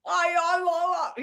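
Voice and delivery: laughing Cockney imitation, wordless and drawn out; Cockney accent. Shown as a demonstration. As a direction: draw out the Cockney vowels. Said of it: "the Cockney accent is, like".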